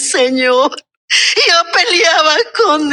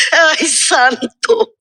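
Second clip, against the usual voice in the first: ordinary talk, nothing marked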